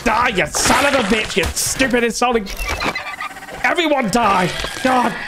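high-pitched gamer rage; raging. Real, not acted. Gamer raging at dinosaurs attacking, raging. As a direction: curse them in a strained high yell.

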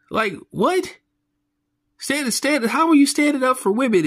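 high, squeaky, mock-outraged; incredulous disbelief. Real, not acted. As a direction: shoot way up in pitch like you can't believe your ears. Mocking a woman's claim in disbelief.

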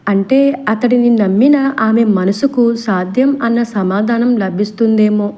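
ordinary delivery

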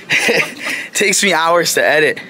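can't finish without giggling; laughing. Acted. Vlogger repeating his line to camera, laughing through it.

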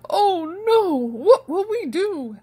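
king in a sudden panic; king panics at the famine news; alarmed king. A narrator's reading. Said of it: "asked the king".

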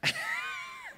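a burst of squealing laughter, very high; real high-pitched shriek of a laugh; shrill laughing squeal. Real, not acted. Laughs at own confession.

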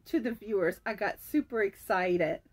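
ordinary talk

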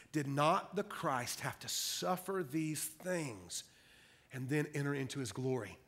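low, soft, measured reading with pauses; solemn scripture reading. Real, not acted. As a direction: read the verse gravely, dropping well below preaching pitch. Reads scripture aloud.

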